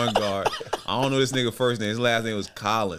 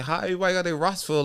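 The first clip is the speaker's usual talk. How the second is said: incredulous; 6 semitones higher, faster than usual. Retold or imitated. Retold baffled reply to agent.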